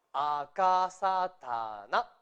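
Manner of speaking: slow rhythmic recital of AKASATANA, syllable after syllable. Shown as a demonstration. Teacher repeating the hiragana columns so far, with rhythm. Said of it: "Hasta ahí con ritmo".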